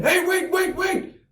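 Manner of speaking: high, urgent mock scream; high-pitched alarmed cry; screaming warning. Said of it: "I start screaming".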